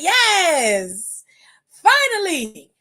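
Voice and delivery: drawn-out high finally; gleeful yes. Retold or imitated. Cheering a storyline twist, high drawn-out delight. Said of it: "I was like".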